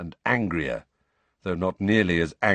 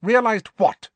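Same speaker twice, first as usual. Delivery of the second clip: sharp, higher question; 13 semitones higher, 6 dB louder than usual. Acted. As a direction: demand it sharply. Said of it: "asked Snape sharply".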